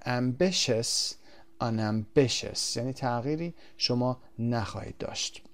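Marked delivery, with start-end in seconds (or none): 0.0-2.8 "Ambitious" then "unambitious", stress kept on "bi"; slow model reading, prefix unstressed; same stress both words. Shown as a demonstration. (تکیه روی همان هجا در هر دو)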